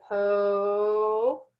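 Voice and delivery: po-tato with the first O stretched out on and on; long held O. Shown as a demonstration. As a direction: hold the O of po-tato, then finish the word. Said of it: "the long O can go on until I'm out of breath".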